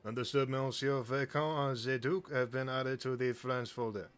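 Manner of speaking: French agent voice, measured; French accent. Acted. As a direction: report back like a French AI. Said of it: "practicing my French accent".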